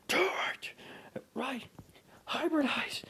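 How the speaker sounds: high frantic cry; high excited yell. Acted. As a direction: squeak it out in alarm. High-pitched excited exchange.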